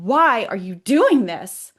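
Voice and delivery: loud, drawn-out WHY; demanding. Retold or imitated. Questioning the energy that attacked her, demanding. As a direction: demand an explanation, slowly and emphatically. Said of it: "the one question that prompted it".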